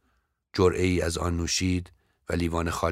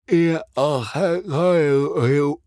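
higher, louder and slow, as if a finger stretches the mouth; mouth pulled aside; 9 semitones higher, 6 dB louder, much slower than usual. Acted. The actress shows her tooth to the young visitor, mouth stretched wide. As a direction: talk with a finger tugging the corner of your lips, dragging each word.

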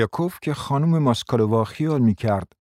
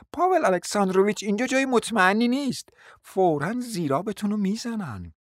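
mocking lady; 9 semitones higher than usual. A narrator's reading. Felisita voiced, mocking.

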